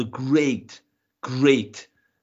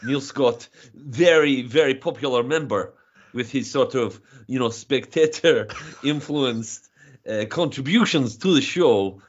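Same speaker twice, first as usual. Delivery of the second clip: hammed-up Russian accent; broad comic Russian accent; mock Russian accent. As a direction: play it as a proud Russian comedian in a thick Russian accent. Comic Russian guest persona.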